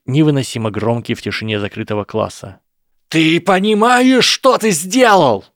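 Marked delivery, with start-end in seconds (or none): 3.1-5.4 much higher, much louder, slow shriek; furious scream. A narrator's reading. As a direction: scream every word out in rage. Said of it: "Его голос перешёл на крик".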